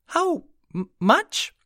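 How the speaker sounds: very high, stumbling echo of the question; puzzled pixie. A narrator's reading. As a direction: repeat it baffled, like a tiny fairy.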